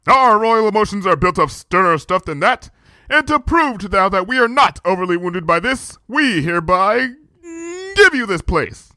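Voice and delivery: stern royal pride breaking into a sob; proud princess, tearing up. A narrator's reading. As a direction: princess insisting she's unhurt while near tears. Luna voiced, proud then tearful.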